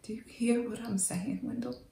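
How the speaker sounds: hushed, intimate whisper. Acted. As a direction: barely breathe the words to him. Intimate confession to Wendell.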